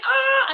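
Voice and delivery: high squeal of nerves, imitated; mock panicked yelp. Nervous Crystal asked to speak, mock high yelp. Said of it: "She was like".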